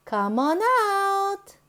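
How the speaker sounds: ordinary delivery, nothing marked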